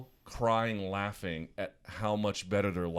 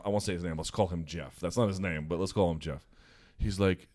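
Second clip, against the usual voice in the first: usual speaking voice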